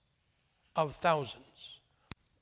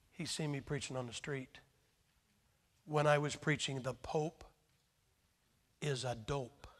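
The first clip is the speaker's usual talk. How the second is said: hushed inner thought; 4 semitones lower, 8 dB quieter than usual. Retold or imitated. Preacher retells his own inner thought.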